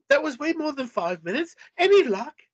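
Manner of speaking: high, impatient British voice; exasperated British woman; Andrea, British. A narrator's reading. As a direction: put on a high-pitched British accent. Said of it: "my Andrea suddenly became British".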